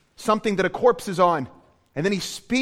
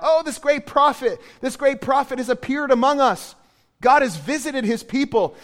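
higher, louder awed exclamation; excited crowd cry; 8 semitones higher, 6 dB louder than usual. Retold or imitated. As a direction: shout it like an amazed crowd. Crowd crying out in awe.

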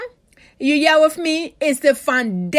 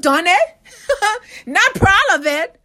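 laughing; 5 semitones higher than usual. Real, not acted. Confessing with laughter.

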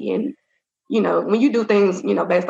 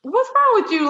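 offended; 12 semitones higher than usual. Retold or imitated. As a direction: voice jumps much higher, indignant disbelief.

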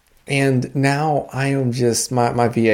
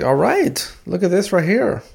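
proud dad; 6 semitones higher, slower than usual. Retold or imitated. Dad proudly praises son.

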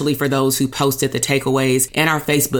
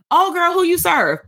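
much higher mocking voice; mock vague pitch; 12 semitones higher than usual. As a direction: send up a weak sales pitch, pitched way up. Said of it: "compared to".

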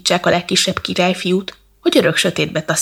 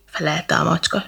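plain reading voice

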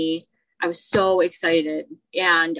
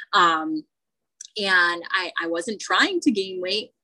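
ordinary delivery

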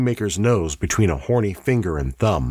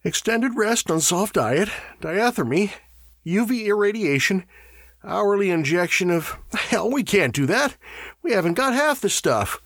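high, strained reading aloud; hoarse; 12 semitones higher than usual. A narrator's reading. Burford reading treatments from the handbook, hoarse. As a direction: read it out in a mildly hoarse, raised pitch. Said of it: "said Burford hoarsely".

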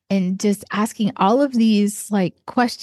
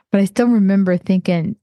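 ordinary delivery

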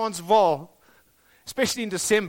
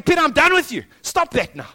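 a hint of an exasperated Jesus, higher and louder; stern rebuke; 6 semitones higher, 6 dB louder than usual. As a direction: scold Peter, fed up. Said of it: "maybe He's saying".

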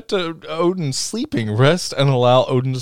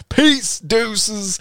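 breezy; 8 semitones higher than usual. Retold or imitated. Frigga voiced leaving husband.

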